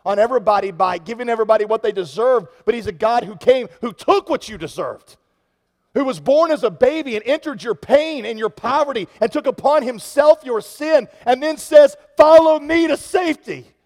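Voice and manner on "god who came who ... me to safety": building to a high, loud call; rising preaching. Acted. Pastor building the sermon to a high, loud climax. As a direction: preach it up to a ringing height.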